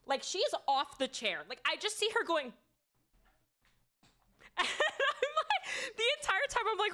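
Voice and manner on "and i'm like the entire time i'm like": real laughter, much higher; laughing hard. Real, not acted. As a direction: barely get it out for laughing. Laughing at Julia's flight.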